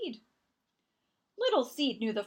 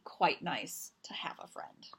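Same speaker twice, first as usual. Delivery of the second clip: whisper; 5 semitones lower, 5 dB quieter than usual. A narrator's reading. Narrator shares seed's secret thought.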